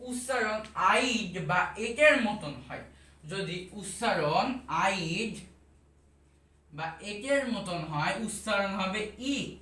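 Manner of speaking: slow, deliberate rule for students; slow teaching. Shown as a demonstration. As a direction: spell out the rule slowly and clearly. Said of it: "teacher states pronunciation rule". (ধীরে, যত্ন করে নিয়ম বোঝানো)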